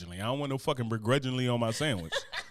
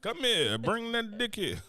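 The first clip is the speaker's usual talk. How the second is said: higher, slow, sultry mock call; hammed-up wife beckoning; 9 semitones higher, slower than usual. Man voicing his wife calling him to bed, hammed-up. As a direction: play the wife luring her man in, higher and slower. Wife's lusty reply voiced.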